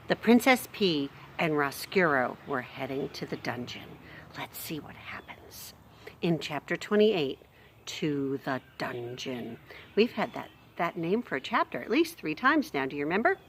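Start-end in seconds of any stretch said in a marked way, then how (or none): 4.4-5.7 whisper. Real, not acted. Teasing aside to children. 7.9-9.6 whisper. Shown as a demonstration. Dramatic chapter title.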